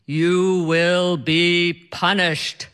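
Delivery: slow booming god voice; mock-ominous deity pronouncement, very slow; deep godlike voice. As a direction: summon the powers of the universe. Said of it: "in this deep, godlike voice".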